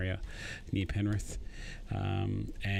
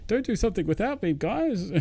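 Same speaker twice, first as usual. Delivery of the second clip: high, fast, louder whiny plea; clingy mate; 11 semitones higher, 5 dB louder than usual. Retold or imitated. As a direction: beg your mates not to leave you out, quickly and high. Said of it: "I was one of those guys, like".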